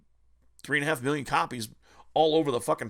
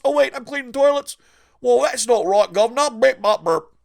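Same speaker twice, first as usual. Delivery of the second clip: high, loud mock Cockney whine; 8 semitones higher, 9 dB louder than usual. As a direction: ham up a Cockney loser complaining. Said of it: "the dipshit in the Cockney accent".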